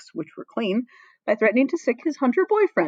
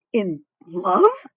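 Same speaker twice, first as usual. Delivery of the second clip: shocked; 6 dB louder than usual. Acted. Shuga shocked by confession.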